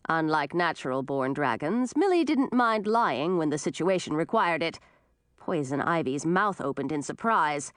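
plain reading voice

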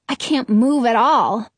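plain reading voice

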